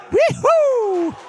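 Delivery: very high, loud whoop; celebratory whoop. Real, not acted. Commentator celebrating the levelling pot, high whoop. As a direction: let out a long, piercing whoop of delight.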